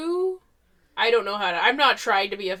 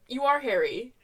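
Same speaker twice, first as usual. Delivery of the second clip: normal talking voice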